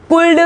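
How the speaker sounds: कुल्ड with l before d; wrong sounded l. Shown as a demonstration. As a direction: misread कुल्ड as kool-du. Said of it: "ना कि".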